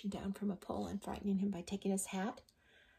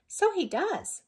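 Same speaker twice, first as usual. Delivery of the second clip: much higher, louder agreeing voice; a chirpy little agreement; little skeleton; 12 semitones higher, 6 dB louder, slower than usual. Acted. Little skeleton agrees with the big one, much higher. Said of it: "said the little skeleton".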